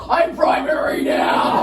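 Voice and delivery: slow, loud victory shout; triumphant shout. Retold or imitated. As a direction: proclaim it like a conqueror. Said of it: "I'm like".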